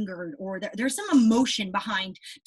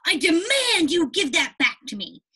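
demanding; 6 semitones higher, 5 dB louder than usual. Shown as a demonstration. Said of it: "You might say".

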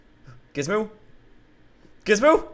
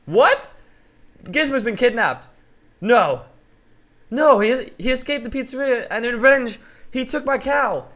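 shocked; 8 semitones higher, 4 dB louder than usual. Acted. Hero repeats letter in shock.

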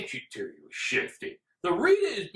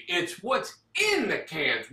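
ordinary talk

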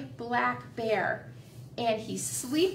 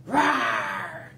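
mock bear growl; 3 semitones lower than usual. Teacher growling as the bear for the kids. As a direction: roar like a grumpy bear.

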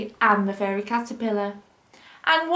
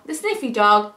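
plain reading voice

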